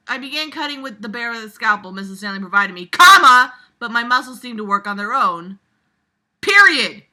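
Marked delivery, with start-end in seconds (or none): 0.1-5.0 rushed, fast read with no pauses; breathless run-on reading, no stops. Shown as a demonstration. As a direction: race through it as if no commas exist. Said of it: "you needed so many commas in here".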